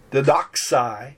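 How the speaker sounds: "didaxai" read slowly; Greek reading. Shown as a demonstration. Teacher reading Greek to students, slow and careful. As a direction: sound out "didaxai" syllable by syllable. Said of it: "I give you an example here".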